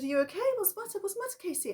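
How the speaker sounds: high, urgent, worried questions; a mother calling out in alarm to her daughter. Retold or imitated. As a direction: rush in asking what's wrong. Mother rushes to screaming child.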